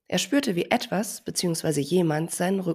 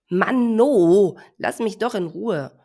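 annoyed shout; 4 semitones higher, 7 dB louder, slower than usual. Acted. Elephant shoos the pestering bird.

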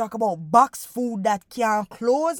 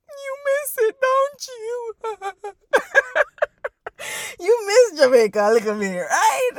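much higher, laughing teasingly; 11 semitones higher, 4 dB louder than usual. Real, not acted. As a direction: tease them with a giggle. Teasing the homesick listener.

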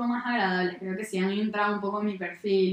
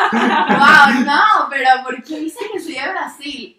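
loud, higher-pitched mock astonishment; incredulous reply; 4 semitones higher, 12 dB louder, slower than usual. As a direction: retell it as a laughing shout of disbelief. Acts out her incredulous reply.

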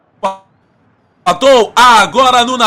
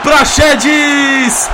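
goal call; 2 semitones higher, slower than usual. Acted.

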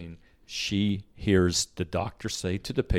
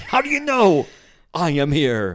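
high, loud, hammed-up disbelief; mock astonishment; 8 semitones higher, 8 dB louder than usual. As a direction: exclaim it as if utterly baffled. Co-host mimics the astonished thought.

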